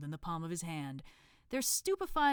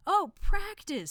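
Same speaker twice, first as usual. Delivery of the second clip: high, slow relieved sigh of words; 13 semitones higher, 9 dB louder, slower than usual. Acted. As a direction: let the worry go out of it.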